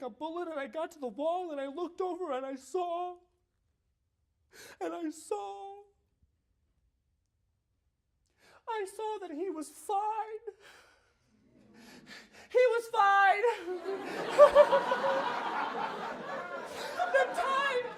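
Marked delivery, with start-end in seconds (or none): 4.8-13.4 high, slow, tearful; crying with relief. Real, not acted. Father finding son safe, crying in relief. 13.5-17.1 high, tearful laugh; relieved laugh. Real, not acted. Father laughing in relief at son safe. 17.2-17.9 high, laughing and crying; laughing through tears. Real, not acted. Father telling of son safe in a puddle, laughing and crying.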